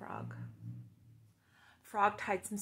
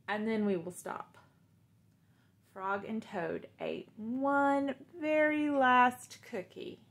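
put-on reading to children; 5 dB louder than usual. Teacher reads Frog and Toad aloud to the class.

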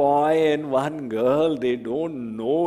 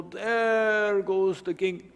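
distant awe; 6 semitones higher, slower than usual. Retold or imitated. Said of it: "I will go".

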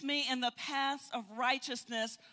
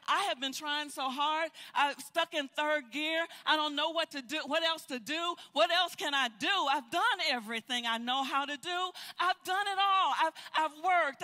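higher and louder, pleading hard with the Lord; desperate pleading prayer; 3 semitones higher, 5 dB louder than usual. Acted. Believer pleading with the Lord over a closed door, loud desperate pleading. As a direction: pour out a frustrated, begging complaint.

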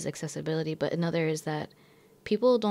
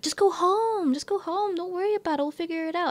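much higher, sing-song reassurance; high coaxing; 11 semitones higher than usual. Retold or imitated. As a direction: coax her to go home, pitched way up. Coworkers urging her to leave.

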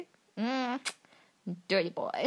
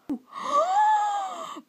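high, sharp breathless gasp. Real, not acted. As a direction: gasp in shock at the photo. Fangirl reacting to photos.

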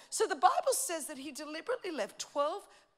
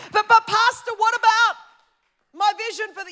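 higher, louder, flustered "but Pastor"; objecting church member; 9 semitones higher, 17 dB louder than usual. Retold or imitated. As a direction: interrupt the pastor like a worried member.

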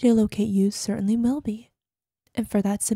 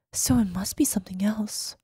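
soft sinister whisper; 3 semitones lower, 5 dB quieter than usual. Acted. Obsessive caller whispers to the missing partner. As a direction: hush it, almost to yourself. Menacing voicemail to fleeing partner.

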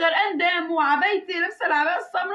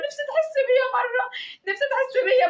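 higher-pitched, insistent plea; retold pleading; 7 semitones higher than usual. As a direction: re-enact begging him to feel for you. تستعطف زوجها في شجار محكي. (استعطاف محكي بصوت أعلى)

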